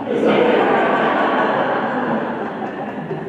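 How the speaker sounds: loud real laugh at his own joke; hearty laugh. Real, not acted. Speaker laughing at his own whole-cow joke, loud.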